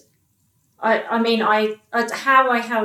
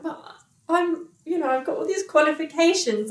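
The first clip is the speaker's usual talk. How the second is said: raised, pleading quote of herself; high protesting self-quote; 8 semitones higher than usual. Retold or imitated. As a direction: pitch it up, pleading to be heard. Retelling her own protest.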